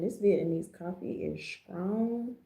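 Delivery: normal talking voice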